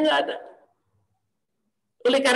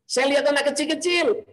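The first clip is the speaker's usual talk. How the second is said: ordinary talk, nothing marked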